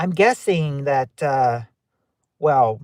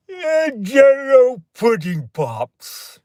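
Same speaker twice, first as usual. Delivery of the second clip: high, loud, very slow drawn-out pitchman voice; booming, slow TV-spokesman voice, much higher; Bill Cosby impression; 9 semitones higher, 5 dB louder, much slower than usual. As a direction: stretch it out like an old pudding ad. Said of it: "My regular Bill Cosby impression".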